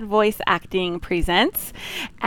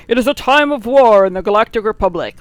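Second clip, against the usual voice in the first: louder, dramatic trailer narrator; Tom Kane narrator voice; 3 semitones higher, 7 dB louder than usual. Retold or imitated. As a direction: announce it like an epic opening crawl. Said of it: "Well, that's Tom Kane".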